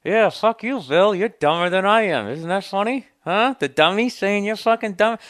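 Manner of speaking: high squeaky dummy voice, loud and cheeky. Pretend. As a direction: sass the ventriloquist in a high puppet voice. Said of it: "talk without moving my fucking lips".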